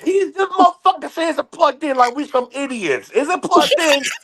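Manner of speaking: mother yelling to her son about the agent, indignant. Retold or imitated. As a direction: demand her son check the plug. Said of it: "She's like".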